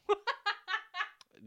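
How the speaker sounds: high bursting laugh; sudden high laugh at herself; burst of laughter. Real, not acted. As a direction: crack up loudly. Laughing at her own slip.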